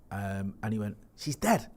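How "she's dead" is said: high-pitched Scouse disbelief; incredulous Scouser, voice jumping high; Scouse accent. Retold or imitated. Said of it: "that's me doing a Scouse accent".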